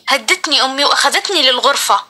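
ordinary delivery

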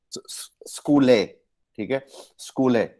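स्कूले broken into a hesitant whispered start, then the stress on कू; second-syllable stress. Shown as a demonstration. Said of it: "second syllable पे stress है".

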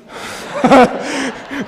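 high, hearty laugh at himself; a real laugh breaking through the sermon; real burst of laughter. Real, not acted. Preacher laughs at own struggle.

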